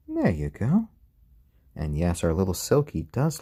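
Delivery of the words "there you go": high sing-song voice for the chicken; high pet talk. Real, not acted.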